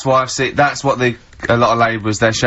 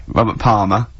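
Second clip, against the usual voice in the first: ordinary talk, nothing marked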